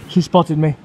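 loud panicked narrator line; alarmed exclaim. Pretend. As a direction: blurt it out in alarm. Louder take of the same words.

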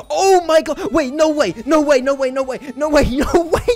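high, loud no way no way; can't believe the rare drop; excited disbelief. Real, not acted. Streamer gets rare drop, high excited disbelief.

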